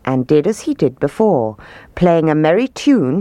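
plain reading voice

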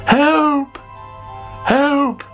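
high-pitched cry for help; calling for help. A narrator's reading. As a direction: call out in distress as a little caterpillar. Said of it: "called Sammy".

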